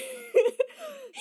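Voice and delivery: high amused laugh. Real, not acted. Laughing at show.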